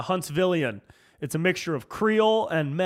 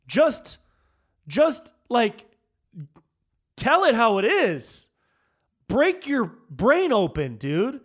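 slow, higher and louder, hammering each phrase; emphatic rant; 5 semitones higher, 3 dB louder, slower than usual. Real, not acted. Telling partisans to think freely, slow emphatic rant. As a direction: spell it out like a frustrated preacher.

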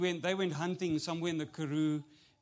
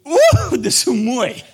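much higher, louder swooning "Ooh"; mock a passer-by gushing over a building site; gushing admirer; 8 semitones higher, 20 dB louder, slower than usual. Imitating someone admiring foundations, high gushing swoon. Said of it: "looking at the foundations and go".

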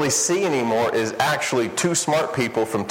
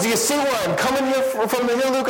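exasperated friend; 12 semitones higher than usual. Retold or imitated. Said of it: "He goes".

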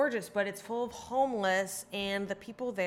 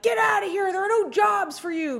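high, loud mocking shout at outsiders; mock yell; 11 semitones higher, 8 dB louder than usual. Locals shouting at a visiting comic, mock high yell. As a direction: yell it like a hostile local, pitched way up. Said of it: "people being like".

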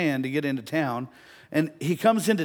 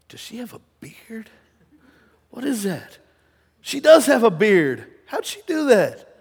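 high-pitched disbelief building from hushed to loud; boy's baffled wonder, growing louder and higher; acted astonishment; 8 semitones higher, 11 dB louder than usual. Acts out boy's astonished reaction.

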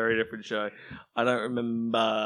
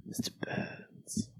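whispered; 12 dB quieter, much slower than usual. Acted. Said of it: "echoed name".

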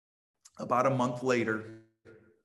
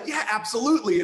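eager; 7 semitones higher, 6 dB louder, faster than usual. Retold or imitated. Philo eagerly agrees.

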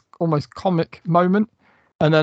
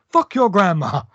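mock-villain retort, higher and louder; Captain Spaulding's comeback; 5 semitones higher, 5 dB louder than usual. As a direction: spit it back like the sneering killer clown. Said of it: "And he goes".

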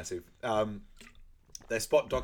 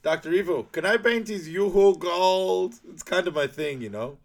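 Goldmember; 8 semitones higher, 8 dB louder than usual. Acted. Goldmember asking Doctor Evil a favour, hammed-up. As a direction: plead in a silly accented character voice. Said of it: "Goldmember goes".

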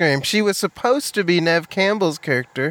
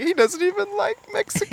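high, teary broken voice; half-sob it like a hurt mom; tearful mom. Retold or imitated. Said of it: "she's like".

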